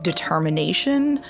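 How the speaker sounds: normal talking voice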